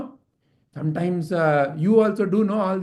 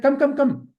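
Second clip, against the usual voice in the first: quick higher call, lightly acted; hurrying summons; 7 semitones higher, 3 dB louder than usual. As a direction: hurry him along. Soldiers hurry him along.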